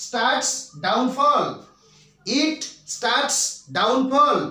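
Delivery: crawling word by word as if for copying; slow classroom dictation. Shown as a demonstration. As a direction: read each word out slowly for the class. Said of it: "দেখুন".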